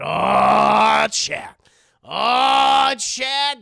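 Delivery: loud, drawn-out "oh, chat"; dramatic ominous exclaim. Real, not acted. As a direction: warn the chat with a loud wail. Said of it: "streamer exclaims to chat".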